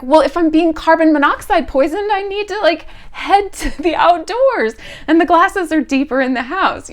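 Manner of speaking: high, loud frantic voice; frantic about poisoning; panicked. Retold or imitated. Panic over carbon monoxide.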